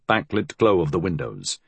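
usual speaking voice

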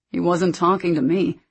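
ordinary delivery, nothing marked

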